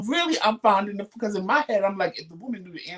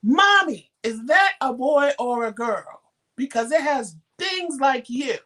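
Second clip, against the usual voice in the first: high, loud imitation of a little girl demanding an answer; loud insistent child; 6 semitones higher, 4 dB louder than usual. Little girl keeps pestering her mother on the train, loud and insistent. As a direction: pipe up loudly, dragging out the question. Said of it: "now she's loud and ev-, drawing attention".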